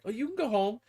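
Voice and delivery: imitated boss, lightly put on; mech boss. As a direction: humor the minion like a boss. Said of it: "the mech boss is like".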